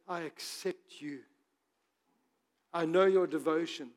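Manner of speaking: lightly voiced comforting Jesus; gentle voice. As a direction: reassure her tenderly. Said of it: "in that gentle voice".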